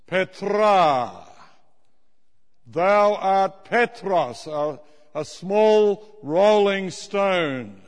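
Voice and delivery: slow, emphatic pulpit delivery; preaching. Acted. Preacher booming scripture to the congregation, slow and emphatic. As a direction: preach every word like scripture.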